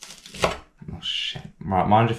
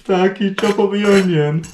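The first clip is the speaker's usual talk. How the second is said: high, louder whiny plea of "Dad, Dad"; pleading child; 11 semitones higher, 5 dB louder than usual. Pretend. Scene: son begging his dad to chop the onion, high whiny plea. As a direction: beg like a helpless kid, pitch way up. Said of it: "I'd say".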